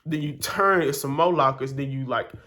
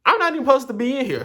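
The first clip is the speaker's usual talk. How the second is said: exasperated; 12 semitones higher, 8 dB louder, faster than usual. Real, not acted. Exasperated aside about locker room.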